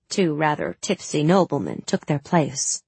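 plain reading voice

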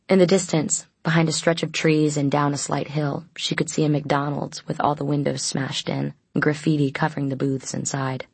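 ordinary delivery

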